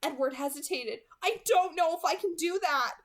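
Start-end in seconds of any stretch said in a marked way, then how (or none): 1.2-3.0 anguished. A narrator's reading. Edward hesitating in anguish.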